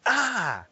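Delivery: put-on sharp high loud baby "Ah!"; frustrated baby shout. Father imitating his baby's frustrated shout, high and loud. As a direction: squeal it like an angry toddler. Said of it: "he just goes".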